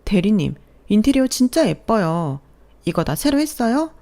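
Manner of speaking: put-on young coworker gushing at the apartment. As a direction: squeal with delight at the pretty rooms. Coworker admiring interior.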